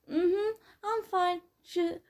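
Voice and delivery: slow, high sleepy yawn through words. Acted. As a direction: yawn while you talk. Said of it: "I yawned".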